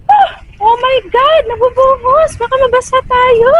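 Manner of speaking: high-pitched startled exclaiming; real alarm. Real, not acted. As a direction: exclaim as water suddenly pours down. Startled by water pouring down.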